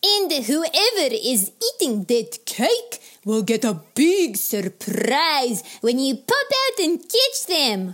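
loud, high yelps with stretched-out words; big, high, drawn-out excitement; excited yelp. A narrator's reading. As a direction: yelp with glee at your own idea. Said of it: "Choler yelped".

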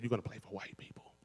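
secret whisper in his ear. Retold or imitated. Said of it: "So she whispered in my ear".

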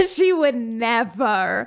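slow, through laughter; chuckling the words; laughing. Real, not acted. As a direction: laugh while you say it. Laughing at the joke.

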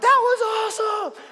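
put-on high, loud, excited shout of a boy; thrilled kid. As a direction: shout it like a kid at the bottom of a hill. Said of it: "He was like".

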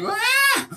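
short high-pitched "aah" cry; sudden high yelp before diving in; a quick, high mock-panicked "aah!"; nervous yell. Voicing his nervous leap.

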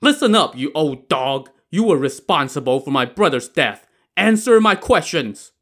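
high, forceful demand; angry roar. Acted. Avenging brother interrogates old woman, angry roar. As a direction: roar an accusation, hardest at start and end. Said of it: "turned to Missus Wang and roared".

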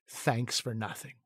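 slow, low, quiet deadpan; sarcastic thanks. Retold or imitated. Two actors answering the director who fought for them, quiet sarcasm. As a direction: mutter it with understated sarcasm.

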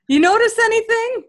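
high, loud coy tease, slowing at the end; coy teasing. Retold or imitated. Retelling her teasing the doctor.

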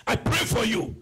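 high, loud declaration over the congregation; fervent preaching prayer. Real, not acted. Preacher blessing the congregation's work, fervent shouted prayer.

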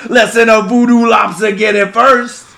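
put-on a bayou judge scolding a Yankee, thick accent; thick Cajun accent. Judge scolding the defendant, thick Cajun accent. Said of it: "hard accents".

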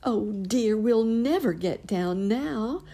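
put-on high, slow, sighing old nurse. A narrator's reading. Nurse Jane sighing, stuck up in the air. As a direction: let it out as a weary sigh. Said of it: "sighed Nurse Jane".

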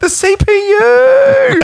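very high, loud, drawn-out cry; mock-dramatic shout. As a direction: holler it like a comic catchphrase.

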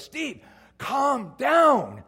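loud, high, drawn-out mock yell; exasperated wife. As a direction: shout it slowly, stretching each word. Wife yelling at panicking husband.

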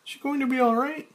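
high, fast woman's voice; Claire. Acted. Claire noticing Frank's change, in a high voice.

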